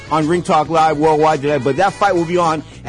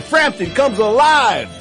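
hyped radio announcer; 7 semitones higher, 4 dB louder, slower than usual. Acted. DJ hypes the music break.

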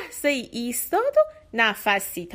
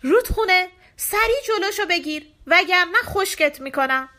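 high, loud threatening yell; demon's shout; 8 semitones higher, 5 dB louder than usual. A narrator's reading. Demon yelling at the river to block the prince, threatening shout. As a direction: yell an order as an enraged story demon. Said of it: "دیو فریاد زد".

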